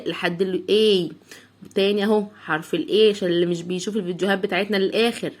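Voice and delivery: ordinary talk, nothing marked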